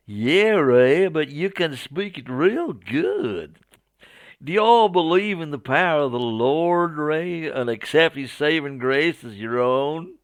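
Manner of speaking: hammed-up Southern American; Southern American accent. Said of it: "my poor attempt at a Southern American accent".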